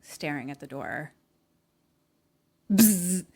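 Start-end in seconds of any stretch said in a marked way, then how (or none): 2.7-3.2 loud, sudden buzz; imitated dryer buzzer. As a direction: buzz sharply like a dryer alarm.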